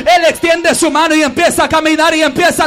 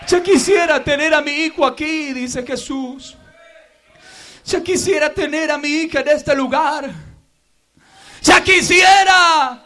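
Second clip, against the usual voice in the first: tender preaching. Real, not acted. Pastor echoing Jesus's longing gently, tender preaching.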